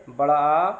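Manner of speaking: the vowel आ held a little longer, slowed. Shown as a demonstration. Teacher showing students a long vowel, slow and held. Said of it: "दीर्घ स्वर".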